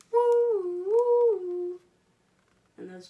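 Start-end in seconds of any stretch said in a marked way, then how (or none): 0.1-1.8 much higher, loud wailing woo woo like a siren; siren imitation. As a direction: sing out the siren for the kids.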